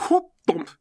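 high whoop, then a thump; throw and thump. Acted. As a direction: imitate something flung and landing. Imitates a throw and impact.

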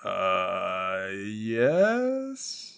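long drawn-out hesitation rising to a questioning squeak; unsure bluff. Acted. Officer bluffing a suspect about jail time, very unsure.

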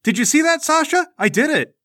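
put-on high, loud boyish triumph; excited kid. Bingle showing off his win to Sasha, excited. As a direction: crow about the win to a friend. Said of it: "He turns around, he goes".

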